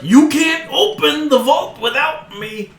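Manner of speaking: high, slow goblin voice; goblin taunt. Acted. Goblin to the dwarves who shot him, high slow taunt. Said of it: "he's like".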